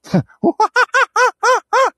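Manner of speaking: high, long laughing bird call; kookaburra cackle. Shown as a demonstration. Guide answers the kookaburras with a high laughing call. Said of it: "trying to just communicate with the kookaburras".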